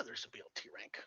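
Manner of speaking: conspiratorial whisper; joking whisper. Retold or imitated. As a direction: whisper it like a shared secret. Playing along with a prank.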